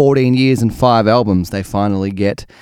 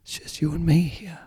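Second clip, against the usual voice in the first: much softer, intimate aside; 5 semitones higher, 11 dB quieter than usual. Real, not acted. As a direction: drop right down, as if confiding in one listener. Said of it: "now it's much more intimate".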